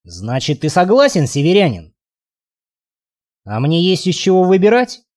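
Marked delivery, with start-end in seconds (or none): none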